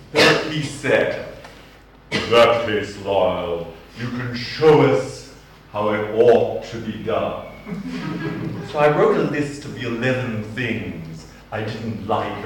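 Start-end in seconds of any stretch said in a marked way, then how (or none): none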